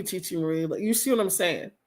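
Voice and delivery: normal talking voice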